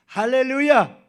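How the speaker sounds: high, very loud sermon crescendo; impassioned preaching. Real, not acted. Pastor marvelling at an elder's words, fired-up preaching. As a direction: preach it at full force, pitch held high.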